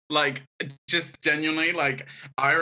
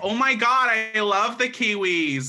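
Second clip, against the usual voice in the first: gushing Americans; 9 semitones higher, 7 dB louder than usual. Retold or imitated. American campers to the Kiwi counselor, gushing loudly. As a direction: gush over them at full volume.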